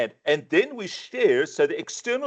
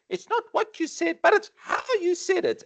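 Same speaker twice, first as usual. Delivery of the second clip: high, put-on sing-song voice; quoting a saying. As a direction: recite the old saying in a voice far higher than your own. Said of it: "who's ever heard this?".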